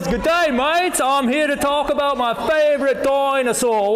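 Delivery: high, loud Aussie showman shouting to a crowd; big, high-pitched hammed-up Australian delivery; Australian accent. Dinosaur talk to children at VBS, loud Australian accent. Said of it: "we kept the Australian accent".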